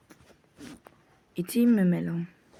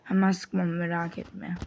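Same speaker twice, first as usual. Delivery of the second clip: usual speaking voice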